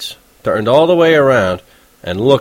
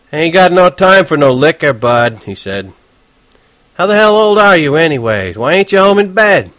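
touchy cabbie; 6 semitones higher, 4 dB louder than usual. Acted. Horwitz explaining fish to Holden, irritated. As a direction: lecture the kid, exasperated.

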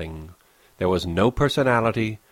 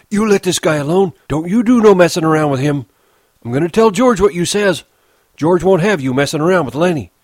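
higher, louder, fast protective warning; angry warning; 8 semitones higher, 8 dB louder, faster than usual. Acted. Old Candy warns Curly's wife off Lenny, angrily. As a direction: warn her off hotly.

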